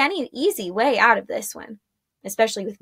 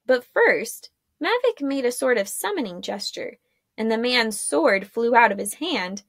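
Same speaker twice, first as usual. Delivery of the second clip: ordinary delivery, nothing marked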